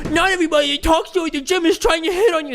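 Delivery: mocking men who deny they're hitting on women; mock guy take. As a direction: parody the dismissive guy's argument. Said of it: "when people are like".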